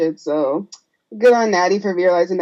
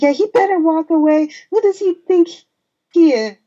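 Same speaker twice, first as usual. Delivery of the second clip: higher-pitched mock indignation; raised-pitch retold huff; indignant retort; 10 semitones higher, 4 dB louder than usual. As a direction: act out an offended friend sticking up for someone. Retold indignant reply about a man.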